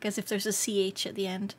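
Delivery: ordinary delivery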